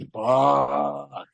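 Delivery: drawn-out stunned curse; retold stunned reaction. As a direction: stretch the word out, stunned. Shaken reaction after robbery.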